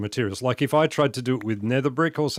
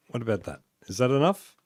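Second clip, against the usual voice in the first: ordinary talk, nothing marked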